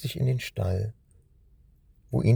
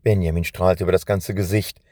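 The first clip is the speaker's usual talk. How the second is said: ordinary delivery, nothing marked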